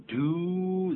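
"do" held long and high, as doo; "do" as a long, sustained oo sound; drawn-out syllable demo. Shown as a demonstration. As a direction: stretch "do" slowly to show the first syllable. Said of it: "然后嘴巴再收下来".

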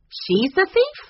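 high rising question; taken aback, incredulous; surprised. A narrator's reading. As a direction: ask in astonishment, pitch shooting up. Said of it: "quite surprised".

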